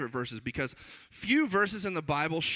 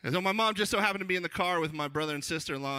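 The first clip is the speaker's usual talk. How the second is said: usual speaking voice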